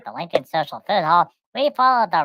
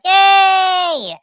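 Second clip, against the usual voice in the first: high, loud gleeful yay; excited cheer; 11 semitones higher, 9 dB louder than usual. Retold or imitated. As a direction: cheer like a delighted little creature. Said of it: "And Pudgy's like".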